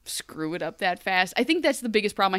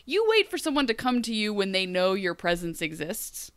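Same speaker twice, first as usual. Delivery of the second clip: normal talking voice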